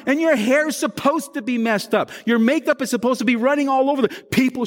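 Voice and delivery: high-pitched, driving sermon delivery; impassioned preaching. Real, not acted. Pastor telling worshippers they come up a mess, impassioned preaching. Preaches on baptism at full force.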